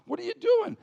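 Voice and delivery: very high-pitched, exasperated challenge; protesting little brother. Acted. Young David questioning his oldest brother, exasperated. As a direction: shoot back at big brother, voice way up.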